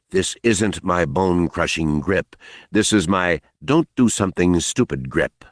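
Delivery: plain reading voice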